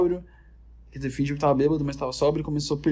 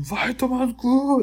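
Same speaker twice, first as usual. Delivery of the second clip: much higher, slow, drawn-out yell; yelled curse; 9 semitones higher, slower than usual. Retold or imitated. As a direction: bellow the insult at the room. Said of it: "um bombadão gritando".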